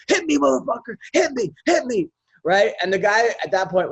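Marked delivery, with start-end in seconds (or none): 0.1-2.1 higher, goading dare; taunting challenge. Retold or imitated. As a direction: bait him to swing first. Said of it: "he goes".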